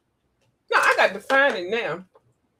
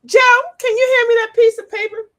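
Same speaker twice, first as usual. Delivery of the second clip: high, loud imitation of her request; exaggerated high-pitched asking; Miss Beth; 13 semitones higher, 8 dB louder than usual. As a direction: call out sweetly high, mimicking a friend. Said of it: "she'll just be asking".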